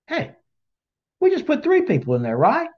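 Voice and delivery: high voiced king, puzzled and checking; astonished king. The king asking his guards about the furnace, high and puzzled. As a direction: pitch up high like a baffled ruler.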